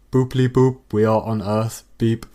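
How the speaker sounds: chirpy put-on robot beep; fake robot noises. AI faking robot beeps to fool its creators. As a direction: pretend to be a simple machine. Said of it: "rehearsed computer noises you're making".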